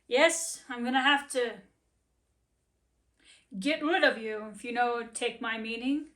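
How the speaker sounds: a stern owner breaking bad news; gruff master. Master voiced in story.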